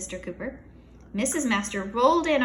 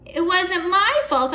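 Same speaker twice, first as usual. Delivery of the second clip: defensive shout; 9 semitones higher, 9 dB louder, slower than usual. Acted. Kid defending himself.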